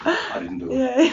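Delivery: high, slow squeaky joke voice; playful squeak; laughing mock denial.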